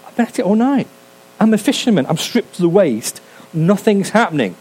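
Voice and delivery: high, plummy English gent calling out; mock upper-class English accent; posh English accent. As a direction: hail the boat like a posh English gentleman. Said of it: "This guy on the shoreline said".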